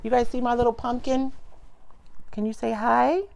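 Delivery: sing-song grandma coaxing. Real, not acted. Coaxing baby to greet viewers.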